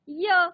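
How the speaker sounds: य as the sound of Y, not its name; letter sound. Shown as a demonstration. Teacher showing children the sound of Y, letter sound य. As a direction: say य alone as a phonics sound. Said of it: "वायचा आवाज होतो".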